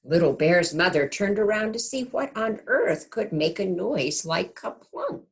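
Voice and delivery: gentle storybook narration; reading to children. A narrator's reading. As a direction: read it like a bedtime picture book. Reads picture book aloud.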